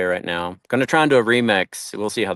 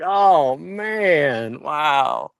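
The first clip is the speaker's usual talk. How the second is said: amused wow; 5 semitones higher, much slower than usual. Real, not acted.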